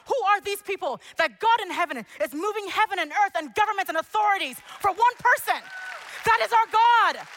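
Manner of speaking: high, loud climactic praise shouts; preacher at full force, shouting God's praise; full-force preaching. Acted. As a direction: lift the whole church with every line.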